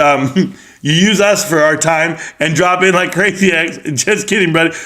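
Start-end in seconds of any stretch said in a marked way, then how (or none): none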